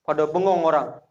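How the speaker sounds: ordinary talk, nothing marked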